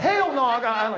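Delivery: loud, high-pitched mock outrage; hammed-up indignant shout; indignant denial. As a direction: blurt it out, acting offended. Acted indignant reply to producer.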